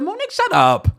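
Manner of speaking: high, loud snap: shut up; telling Monique off. Retold or imitated. Yelling at Monique to shut up, high and loud. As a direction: bark it at her, high and sharp. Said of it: "I said".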